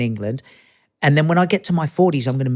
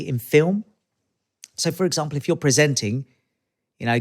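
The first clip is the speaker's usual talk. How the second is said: usual speaking voice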